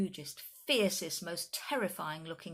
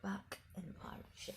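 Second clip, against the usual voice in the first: soft whispered aside; hushed storyteller whisper; 11 dB quieter than usual. Real, not acted. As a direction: breathe it to the listeners.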